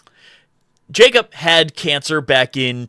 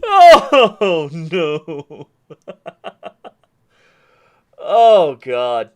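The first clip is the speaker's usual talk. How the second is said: laughing cry; 3 dB louder, much slower than usual. Real, not acted. Laughing fit after story.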